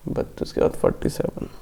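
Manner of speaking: soft whisper to yourself; whispered aside. Real, not acted. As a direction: whisper the number under your breath. Muttering the question number aside.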